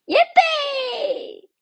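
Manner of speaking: very high, loud joyous whoop; a gleeful cheer. Acted. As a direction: shout for pure joy while flying. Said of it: "he whooped".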